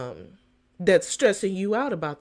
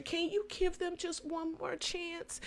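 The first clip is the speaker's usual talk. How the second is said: soft pleading; 11 semitones higher, 9 dB quieter than usual. Retold or imitated. Pleading with the HR manager.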